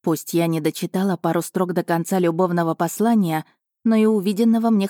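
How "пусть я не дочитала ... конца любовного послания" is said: ordinary delivery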